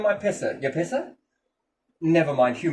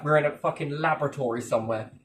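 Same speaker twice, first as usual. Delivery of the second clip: ordinary talk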